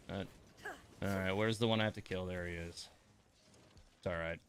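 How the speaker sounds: ordinary talk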